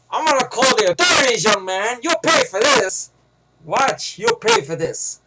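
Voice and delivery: high, loud theatrical threat; very dramatic. Retold or imitated. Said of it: "Very, very dramatic".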